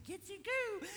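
very high squeaky coo; cutesy baby talk. Acted. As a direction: tickle a baby with an exaggerated falsetto. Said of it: "huge face and these gigantic hot dog lips leaning".

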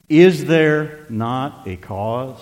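drawn out, every word weighed; slow emphatic question. Shown as a demonstration. Preacher repeating David's question, slow and emphatic. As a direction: lean into cause slowly. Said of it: "translations don't do good service to that verse".

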